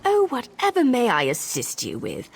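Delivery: mock servile sarcasm. Disguised servant teasing a pampered visitor, mock servile sarcasm. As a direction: simper the offer of help, dripping with scorn.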